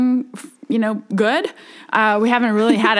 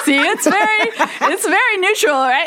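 laughing; 8 semitones higher than usual. Real, not acted. Guest laughing at the host's journalist joke, laughing. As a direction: chuckle while defending herself.

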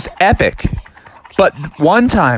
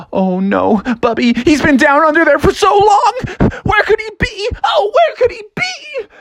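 hammed-up worry climbing to a squeal; nervous panic; 12 semitones higher than usual. Onlookers search for the sunken bear, rising squealed panic. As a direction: fret higher and higher, frantic. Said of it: "everybody was so nervous".

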